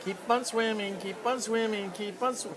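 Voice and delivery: high sing-song little fish. Acted. Dad voicing a swimming fish for his kids, high sing-song. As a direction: chirp it like a cheerful fish.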